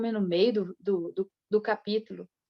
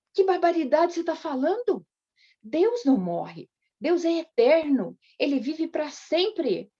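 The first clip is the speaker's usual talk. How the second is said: indignant rebuke; 7 semitones higher than usual. Retold or imitated. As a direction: correct her firmly, drawing the words out. Husband rebukes wife about God.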